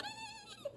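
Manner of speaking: very high, thin squealed horse whinny. Retold or imitated. Storyteller meant a monkey but neighs like a horse. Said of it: "That was a horse".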